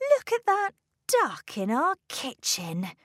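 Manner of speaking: high, dismayed exclamation; indignant cry. A narrator's reading. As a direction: cry out at the outrage. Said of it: "cried Missus Gregg".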